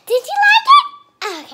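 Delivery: laughing squeal. Real, not acted. Girl asking viewers after dancing, laughing squeal. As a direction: giggle out the question.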